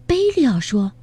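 plain reading voice

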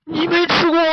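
high, loud startled question; jolted by the news; shocked. Acted. Master startled by the pig's answer, high and loud.

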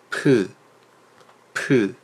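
p, p, p on strongest airflow; strong aspiration. Shown as a demonstration. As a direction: push maximum air through each p. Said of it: "送气的气流是最强的嘛".

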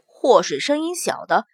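plain reading voice

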